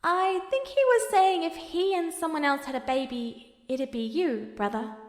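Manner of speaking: a hint of embarrassment; a little embarrassed. A narrator's reading. Alphonse explaining the joke to his brother, embarrassed. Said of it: "a little embarrassed".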